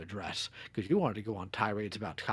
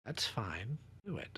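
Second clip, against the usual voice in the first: whisper; 11 dB quieter, slower than usual. Real, not acted. Urging quietly, mother asleep.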